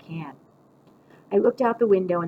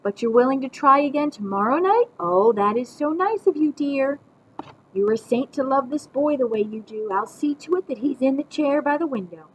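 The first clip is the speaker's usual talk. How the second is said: lightly gushing grandma, higher voice; pretend phone call; 7 semitones higher, 4 dB louder than usual. A narrator's reading. Grandma thanking pretend Mom for another chance, gushing. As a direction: flatter an imaginary caller. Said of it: "say into the phone again".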